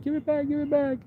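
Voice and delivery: put-on very high, pleading little girl; squeaky high little girl voice. Voicing the little girl begging dad for the bike, very high squeak. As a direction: squeak it like a small child begging. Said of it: "she's gonna be like".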